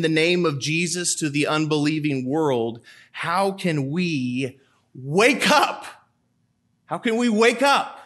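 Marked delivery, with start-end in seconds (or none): 3.1-5.9 slow earnest plea to the congregation; slow pleading question. Acted. Rhetorical plea, repeated. 6.8-8.0 higher, pressing question; higher urgent plea to the congregation. Acted. Repeated plea, raised.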